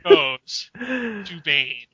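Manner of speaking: slow, booming award reveal; dramatic announcement. Acted. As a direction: announce it like a grand award show.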